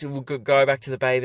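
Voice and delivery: ordinary talk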